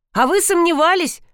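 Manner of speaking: high-pitched surprised question; mild surprise. A narrator's reading. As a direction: ask it high, taken aback. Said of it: "удивился незнакомец". (удивлённо, высоким голосом)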